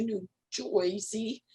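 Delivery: lightly put-on New Jersey accent; drawn-out "Joysey" New Jersey twang. Grandmother recalling her friend's move, mock New Jersey accent. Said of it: "and a New Jersey accent".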